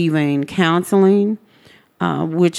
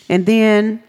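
usual speaking voice

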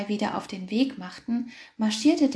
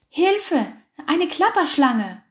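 high, loud panicked yell; scared shout; 6 semitones higher, 6 dB louder, slower than usual. A narrator's reading. Shepherds fleeing a rattlesnake, high panicked yell. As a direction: cry out and run. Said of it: "schrien sie".

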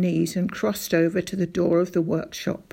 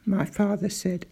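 ordinary delivery

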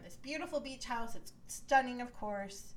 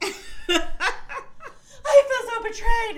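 very high, loud, laughing mock gasp; mocking the housewives' outrage at the reveal; mock betrayed wail; 13 dB louder, slower than usual. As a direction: ham up the outrage as a joke.

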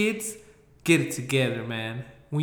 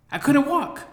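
couldn't walk, said much higher and louder; high squeaky repeat; 12 semitones higher, 4 dB louder than usual. Shown as a demonstration. Repeating himself to the audience, much higher and squeakier. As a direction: pitch the second "I couldn't walk" way up.

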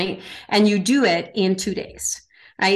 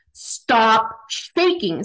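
slow, drawn-out stern order from a commander; drill commander; much slower than usual. Retold or imitated. Commander ordering the shivering recruit to stop shaking, slow and stern. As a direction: lay down the order word by word.

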